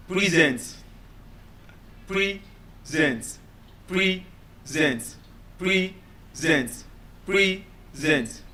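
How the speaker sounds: measured, separated syllables on PRESENT; stress demo. Shown as a demonstration. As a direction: PRESENT broken slowly into PRE and SENT. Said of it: "my stress has changed the meaning".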